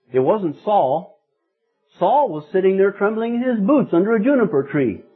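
ordinary delivery

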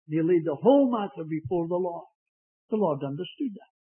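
ordinary delivery

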